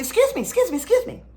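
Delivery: high, quick excuse-me call; politely flag someone down, pitched high; light high-voiced interruption; calling for attention. Calling to pastor from behind.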